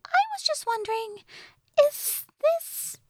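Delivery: very high, tentative fairy voice; Tattle. A narrator's reading. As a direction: ask haltingly in a tiny squeaky pitch. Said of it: "Tattle said".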